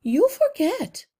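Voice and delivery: a small mother holding her ground; mother mouse. A narrator's reading. Mother mouse pleads with the rats, slow and earnest. Mrs Frisbee voiced.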